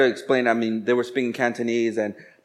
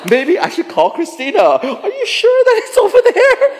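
very high, loud comic voice; mock-innocent wondering; 7 dB louder than usual. Man imagining calling Christina, mock-innocent high voice. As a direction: push it up into a silly squeal. Imagined sweet question to wife.

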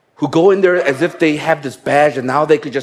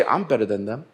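proud inner voice; 8 dB quieter than usual. Retold or imitated. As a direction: sneer it quietly to yourself, full of pride. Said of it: "gonna get proud and say".

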